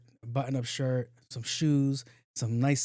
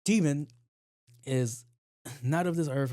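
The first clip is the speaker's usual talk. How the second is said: dramatic hunter; 3 semitones higher, slower than usual. Retold or imitated. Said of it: "he was like".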